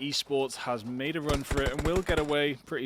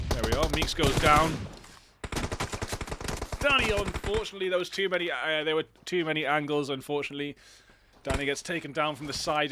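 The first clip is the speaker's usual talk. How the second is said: excited play-by-play; 2 semitones higher, 4 dB louder than usual. Acted. Live play-by-play.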